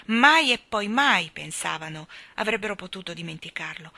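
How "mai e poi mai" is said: plain reading voice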